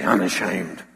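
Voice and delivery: low, firm proclamation; proud Paul. Retold or imitated. Paul on the beach, standing tall: proud low declaration. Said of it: "bark out the words".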